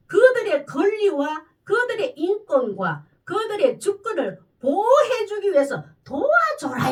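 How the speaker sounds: loud, slow, emphatic preaching; forceful preaching. Acted. Preacher to congregation, slow and loud. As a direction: drive home each word from the pulpit.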